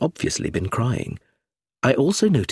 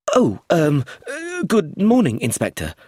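frightened; 8 semitones higher than usual. A narrator's reading. Nervous tutor greeting inspector, frightened and hesitant. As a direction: stammer in nervous fear. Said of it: "looking very frightened".

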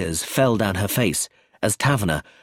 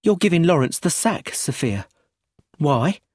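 abrupt, higher-pitched challenge; blunt question in a raised pitch; 9 semitones higher than usual. A narrator's reading. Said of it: "Brenda said abruptly".

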